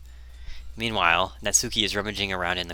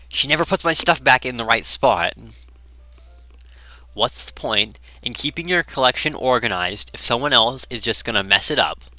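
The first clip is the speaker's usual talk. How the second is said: annoyed; 2 semitones higher, 6 dB louder than usual. Acted. Natsuki griping about her messed-up collection, annoyed. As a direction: complain with irritated force. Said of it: "She seemed to be annoyed".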